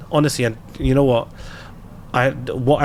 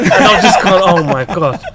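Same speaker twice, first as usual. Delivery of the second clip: laughing; 4 semitones higher, 9 dB louder than usual. Real, not acted. Laughing over son's insult.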